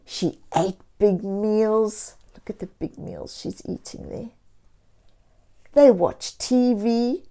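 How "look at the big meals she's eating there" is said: soft, fast whisper; a hushed aside to children about the picture. A narrator's reading. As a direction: point it out under your breath.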